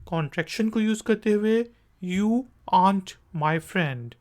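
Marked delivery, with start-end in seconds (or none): 2.0-4.1 aren't said as ant, no r; clipped aren't, r silent; quick contraction. Shown as a demonstration. Teacher demoing the faster contracted form. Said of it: "faster form".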